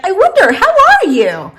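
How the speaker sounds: ARE high and stretched, falling on YOU; wondering emphasis on ARE. Shown as a demonstration. Teacher adds meaning to the question, pondering with ARE emphasized. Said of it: "like, emphasizing".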